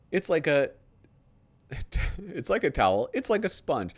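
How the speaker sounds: lightly hammed-up TV pitchman read; infomercial read. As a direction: sell it like a late-night ad. Reads a parody TV ad.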